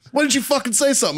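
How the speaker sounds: fast, high-pitched retold protest; mock indignation. Gunny learning the kid was hazed, mock indignant protest. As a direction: imitate gunny demanding to know.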